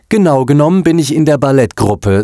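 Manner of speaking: put-on high-pitched, haughty little girl; snooty. A narrator's reading. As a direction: correct him with your nose in the air. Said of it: "sagte das Mädchen hochnäsig". (hochnäsig, mit hoher Stimme)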